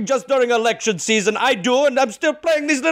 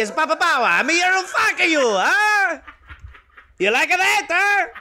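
put-on Italian accent; 6 semitones higher than usual. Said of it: "went to Italian".

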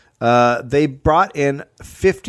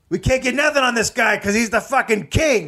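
frustrated agent; 11 semitones higher than usual. Retold or imitated. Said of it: "So they were like".

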